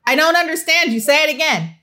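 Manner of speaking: mother raising her voice in impatience; impatient mom pushing her child to repeat. Retold or imitated. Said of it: "And she gets louder".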